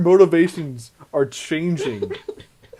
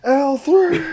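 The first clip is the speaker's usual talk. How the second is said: high, loud hammed-up wail; mock anguished scream; 9 semitones higher, 6 dB louder than usual. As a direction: cry out the name in mock grief. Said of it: "sit there and scream".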